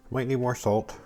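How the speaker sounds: normal talking voice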